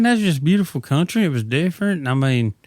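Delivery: usual speaking voice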